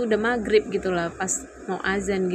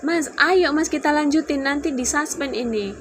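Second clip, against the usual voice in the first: high, raised pleading call; calling after someone; 8 semitones higher, 4 dB louder than usual. Retold or imitated. Wife calls after husband walking off, urgent retold call. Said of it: "aku panggilin".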